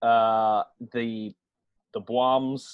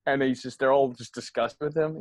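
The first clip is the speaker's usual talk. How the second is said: usual speaking voice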